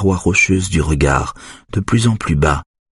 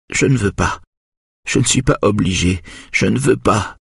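whimpering; 5 semitones higher than usual. A narrator's reading. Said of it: "gémit Joseph".

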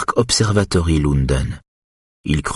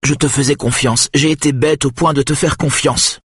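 higher-pitched hurt wife; voiced wife, hurt; 10 semitones higher than usual. A narrator's reading. Wife reproaching husband for broken trust, hurt. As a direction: lightly raise the voice for the betrayed wife.

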